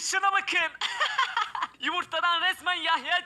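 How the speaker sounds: laughing through the words. Real, not acted. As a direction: burst out laughing, high. Funny egg reveal. (gülerek konuşma)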